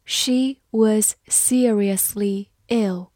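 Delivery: each word slow and drawn out; careful slow reading. Shown as a demonstration. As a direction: read it slowly for learners. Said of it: "好，慢慢来读。".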